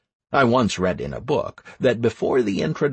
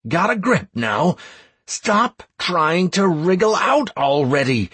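strained, louder command; shouting; 7 semitones higher, 3 dB louder than usual. Acted. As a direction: shout it with effort. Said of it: "I shouted".

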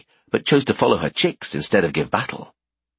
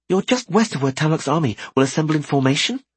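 put-on higher, brighter girl voice; young woman voice; 7 semitones higher than usual. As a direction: play Merry, lively. Merry's voice.

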